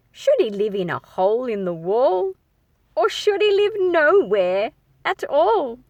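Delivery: put-on lilting, sing-song questions to young listeners; playful read-aloud. A narrator's reading. Storyteller asking the kids where the mouse should live. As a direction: bounce through each rhyming question. Reading to children.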